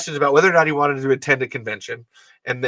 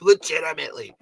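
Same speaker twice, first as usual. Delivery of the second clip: ordinary delivery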